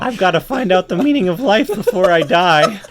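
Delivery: high, loud ant voice; teenage ant. Acted. Ant growing into a teenager, high urgent voice. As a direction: cry it out as a young ant. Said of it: "I must be turning into a teenager".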